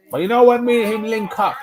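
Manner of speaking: high, loud, fast hype; excited burst. Real, not acted. Streamer hyping his friendship with Berksland, high and fast. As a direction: get fired up about the duo. Hyped about friend link-up.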